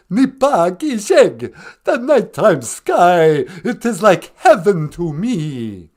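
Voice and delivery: put-on loud, theatrical vampire count with an accent; Count Von Count. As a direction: ham up the counting vampire, rolling "ah, ah". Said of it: "the Count".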